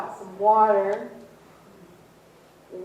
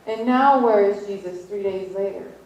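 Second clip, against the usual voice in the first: normal talking voice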